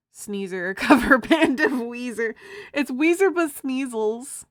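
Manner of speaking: loud, high, bursting with laughter; laughing shout. Real, not acted. Streamer shouts a pun to chat, laughing loudly. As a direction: blurt the pun out while cracking up. Cracking up at own joke.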